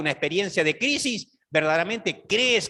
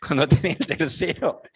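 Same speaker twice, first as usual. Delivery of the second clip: talking through real chuckles; laughing. Real, not acted. Preacher amused at his own joke about a third child, laughing. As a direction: let the laugh break into the words.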